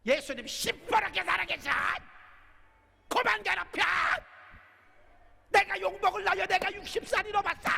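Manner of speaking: high loud fierce retort; defiant shout. Shown as a demonstration. Pastor demonstrating what wives should shout at husbands, defiant. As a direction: stare him down and shout it. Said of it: "그럼 눈 똑바로 뜨고".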